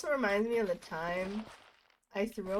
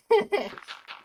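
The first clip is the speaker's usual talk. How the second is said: burst of laughter; 11 semitones higher, 9 dB louder than usual. Real, not acted. Laughing at own joke.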